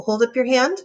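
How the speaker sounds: usual speaking voice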